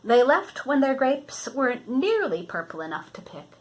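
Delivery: reading a picture book to a grandchild; storybook reading. Acted. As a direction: read it out gently, like a bedtime story. Grandmother reads picture book aloud.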